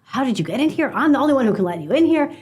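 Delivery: mock angry outburst, half-acted; retold anger. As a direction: play the furious gatekeeper scolding. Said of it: "the amount of anger and the voice".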